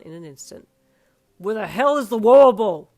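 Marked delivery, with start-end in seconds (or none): 1.4-2.9 loud furious yell. Acted. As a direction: yell it across the room. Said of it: "he yelled loudly".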